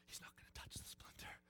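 unvoiced whisper to herself; whispered self-reassurance. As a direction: whisper it to calm yourself down. Child reassuring herself softly.

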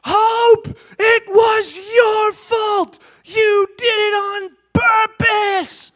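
put-on high, slow wailing little girl accusing; crying child's shout. Father imitating his daughter's high wail at her sister. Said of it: "I hear my other daughter's name".

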